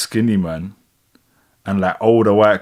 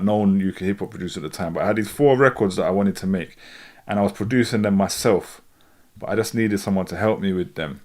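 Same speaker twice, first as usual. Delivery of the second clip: normal talking voice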